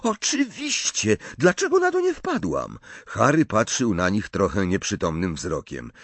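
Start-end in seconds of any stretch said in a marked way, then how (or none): 0.0-2.8 very high, amazed at herself; excited realisation. A narrator's reading. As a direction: exclaim as it suddenly becomes obvious. Hermiona zachwycona pomysłem. (podekscytowane olśnienie, bardzo wysokim głosem)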